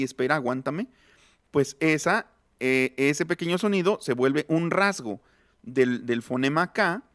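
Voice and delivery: ordinary talk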